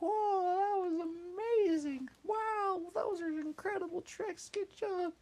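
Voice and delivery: very high amazed cheering; amazed crowd. Acted. Crowd praising Hop's landing, very high amazed gush.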